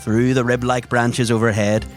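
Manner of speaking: ordinary delivery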